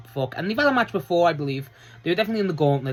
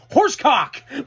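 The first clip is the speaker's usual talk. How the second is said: louder, higher shouted word; mock shout; 10 semitones higher, 5 dB louder, slower than usual. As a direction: yell it like a crowd call. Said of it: "he goes".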